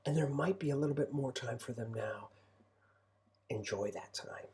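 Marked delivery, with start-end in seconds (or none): 3.5-4.5 hushed, whispered, low and gentle; soft sincere wish. Real, not acted. Father gently urging listening parents, softly whispered. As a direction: whisper it like a heartfelt blessing.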